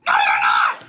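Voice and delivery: low, loud snap; angry retort. Acted. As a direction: bark the denial at him. Host snaps at wannabe.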